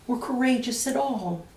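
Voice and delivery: usual speaking voice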